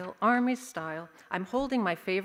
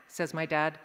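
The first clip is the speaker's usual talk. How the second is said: ordinary delivery, nothing marked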